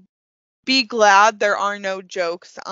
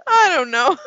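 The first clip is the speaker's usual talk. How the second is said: louder, higher playful repeat; loud echo; 6 semitones higher, 5 dB louder than usual. Acted. Echoing the other host, louder.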